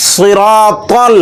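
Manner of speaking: সিরাতাল recited slowly with a firm Arabic ত; slow careful ত. Shown as a demonstration. Teacher models সিরাতাল slowly for students. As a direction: stretch সিরাতাল out, each sound clear. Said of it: "ত যেন তার মতো না হয়ে যায়".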